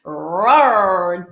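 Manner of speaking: a light mock tiger roar; playful cartoon roar, lightly done; Tony the Tiger roar. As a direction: growl it like the cereal tiger. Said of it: "Tony the Tiger".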